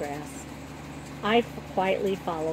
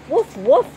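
loud, higher woof woof like a dog; mock dog bark; 6 semitones higher, 10 dB louder than usual. A narrator's reading. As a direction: bark to cover the kitten. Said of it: "Josie says in response".

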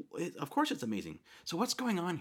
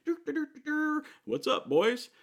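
much higher, breezy humming then a cheery greeting; casual Jesus; 4 dB louder than usual. Retold or imitated. Jesus strolling by the struggling boat, breezy and cheery. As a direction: hum along carelessly, then greet the boys.

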